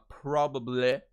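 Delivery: PROBABLY ending in an open, stressed 'ih'; emphasized British ending. Shown as a demonstration. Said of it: "a little bit more emphasis on the I".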